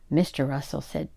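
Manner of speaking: plain reading voice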